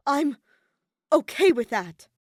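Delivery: loud, curt retort; snapping. Acted. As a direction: bite off the words. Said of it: "Shuichi snapped over his shoulder".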